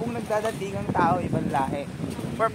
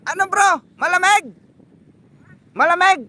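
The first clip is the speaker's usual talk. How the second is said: calling out; 10 semitones higher, 11 dB louder than usual. Real, not acted. Calling to friend in water.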